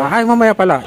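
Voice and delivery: ordinary talk, nothing marked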